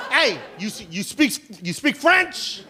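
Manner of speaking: mock cop calling out, rising on French; cop's voice. Cop calling out to the comedian, mock questioning. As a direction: hail him like a pushy cop. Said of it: "He goes".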